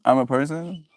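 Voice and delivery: usual speaking voice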